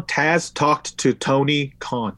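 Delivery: slow, deliberate word by word; measured, careful repeat of a tongue twister. Shown as a demonstration. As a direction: spell out each word slowly. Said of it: "Say that three times".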